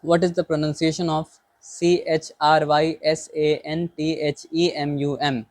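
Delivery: spelled out letter by letter, slowly; slow dictation. Shown as a demonstration. Teacher asking the phone assistant, spelling letters slowly. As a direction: spell each letter clearly for the device. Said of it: "dictates query to a voice assistant".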